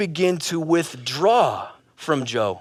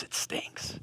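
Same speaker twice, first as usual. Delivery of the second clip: whispered behind someone's back; hushed aside; 19 dB quieter than usual. Acted. Family confiding about smelly Joe, hushed whisper. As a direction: drop to a barely audible whisper.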